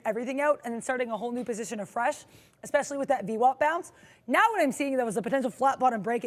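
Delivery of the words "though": ordinary talk, nothing marked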